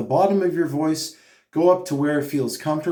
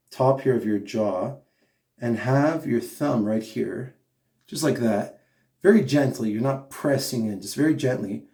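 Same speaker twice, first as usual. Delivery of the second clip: ordinary delivery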